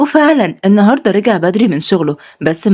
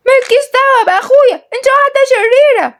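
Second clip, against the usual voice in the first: put-on very high little girl's voice, indignant; a small girl standing up for her brother; child voice. Girl defending her brother against the woman, indignant child voice.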